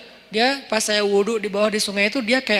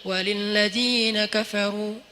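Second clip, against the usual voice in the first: slow, chanted tilawah; Quran recitation; much slower than usual. Acted. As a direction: recite it slowly like at a mosque. Reciting Surah Al-Mulk. (tilawah pelan dan berirama)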